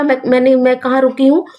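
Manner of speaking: ordinary delivery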